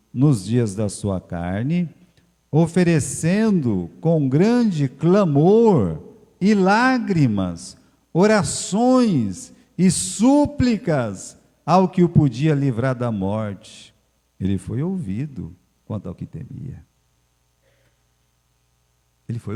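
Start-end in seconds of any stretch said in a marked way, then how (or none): 2.5-13.9 slow, loud, drawn-out recitation; fervent scripture reading. Acted. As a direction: lean into every word, slowly.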